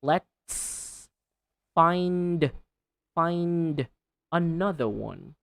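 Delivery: word by word, long TS in let's, full D in find; careful, separated endings; clear final sounds. Shown as a demonstration. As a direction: pause after "Let's" and "find", sounding their last consonants. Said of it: "You should say".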